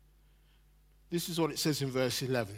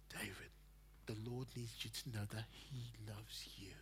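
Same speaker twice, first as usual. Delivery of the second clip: tender whisper; 5 semitones lower, 19 dB quieter than usual. Retold or imitated. Worship leader's tender message.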